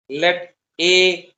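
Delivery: slow, spaced dictation pace; dictating. Shown as a demonstration. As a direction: read it out word by word for writing. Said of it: "dictation to class".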